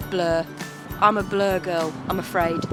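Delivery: normal talking voice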